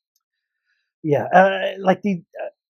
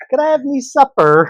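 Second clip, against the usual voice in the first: mock English accent, pitched much higher like a small boy; little boy's English accent; 10 semitones higher, 6 dB louder than usual. Wife mocking her husband's accent as a small boy doing English. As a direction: tease him with a childish English accent. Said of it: "a little boy trying to do an English accent".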